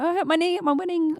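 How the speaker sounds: put-on high, light New Zealand accent; a quick jokey New Zealand accent. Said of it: "That was my New Zealand accent".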